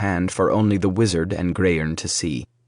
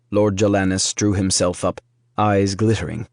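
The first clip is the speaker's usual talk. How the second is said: plain reading voice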